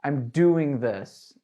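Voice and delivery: slow and firm, "doing" leaned on; stress on doing. Shown as a demonstration. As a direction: say "I'm doing this" slowly, weight on "doing".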